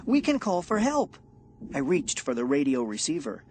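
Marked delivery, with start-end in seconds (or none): 0.1-1.2 high, loud eager voice; hopeful and urgent; excited. A narrator's reading. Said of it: "I cried".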